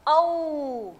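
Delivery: औ drawn out as an "au" glide; long, careful teacher's model of औ; demonstrated pronunciation. Shown as a demonstration. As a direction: stretch औ, gliding from "a" into "u". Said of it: "ऐसे बोल रही हूँ".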